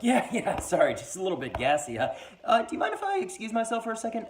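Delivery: high, loud strained cover-up; forced casual. Acted. Narrator brushing off his friends while nauseous, strained high cover. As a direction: pretend everything's fine while gagging. Faking he's fine, about to vomit.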